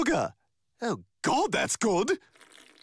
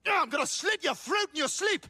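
higher shouted threat; furious threat; 7 semitones higher than usual. Acted. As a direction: threaten him hotly.

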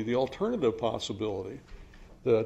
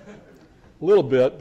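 slow, louder, with a chuckle; laughing concession; 4 semitones higher, 9 dB louder, slower than usual. Shown as a demonstration. Lecturer admits his proof is thin, laughing slowly. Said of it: "repeats the phrase as a concession".

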